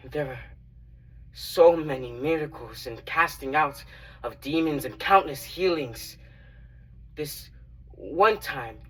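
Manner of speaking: earnest, animated dramatic storyteller; Matthew. Acted. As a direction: recount miracles with growing, emphatic amazement. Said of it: "Today's monologue is Matthew's testimony".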